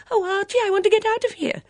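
high, loud, rushed wail; fast piping panic; panicked plea. Acted. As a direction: beg a husband to escape. Wife panics in the dark.

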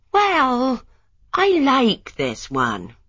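lightly voiced wife standing firm; stubborn young wife. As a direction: dig in on liking the house. Lily insisting on house.

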